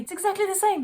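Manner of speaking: normal talking voice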